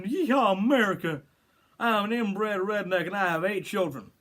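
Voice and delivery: exaggerated inbred-hillbilly Southern accent. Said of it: "That type of accent".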